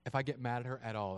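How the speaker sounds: usual speaking voice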